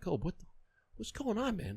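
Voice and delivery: high, surprised disbelief; can't believe he's still here; baffled teammate. Retold or imitated. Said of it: "I'm like".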